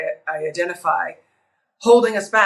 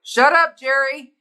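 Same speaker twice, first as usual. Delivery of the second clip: high, punchy mock yell; 6 semitones higher, 4 dB louder than usual. Mother hollering at her daughter's inner critic, mock yell. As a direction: playfully yell it, pitched high. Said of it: "I'll yell, like".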